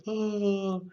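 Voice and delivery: high, held moaning "oh"; demonstrated moan. Shown as a demonstration. Teacher illustrates what moaning is, a sustained moan. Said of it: "like a scream or a cry".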